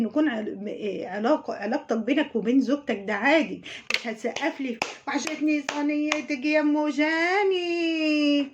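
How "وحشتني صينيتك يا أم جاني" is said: slow, stuffy-nosed fake sob; nasal mock crying. Mocking a YouTuber's stuffed-nose crying voice. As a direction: mimic a blocked-nose whimper. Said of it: "وهي بصوت شتوي واضح بقى، وهي بتخنف كده".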